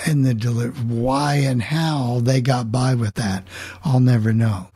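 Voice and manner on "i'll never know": ordinary delivery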